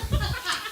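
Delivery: quick high airless giggle; a real little laugh at herself while fumbling the doll; breathy giggle. Real, not acted. Pastor fumbling the nesting doll for the congregation, giggling.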